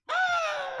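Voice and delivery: loud, high burst of laughter; shrieking laugh. Real, not acted. Cohost bursting into a shrieking laugh at the teasing. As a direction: crack up hard at the joke. Friend mocked for his voice.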